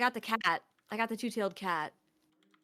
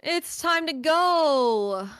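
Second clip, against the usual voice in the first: slow, louder and higher; drawn-out announcement; 4 semitones higher, 6 dB louder, much slower than usual. Acted. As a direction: stretch it out like a sad farewell. Streamer announcing end dramatically.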